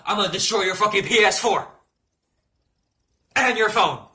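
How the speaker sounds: loud, punchy imitation of mom's threat; bellowed ultimatum, hard on each word; threatening mom. Mom threatens son in the waiting room, loud threat.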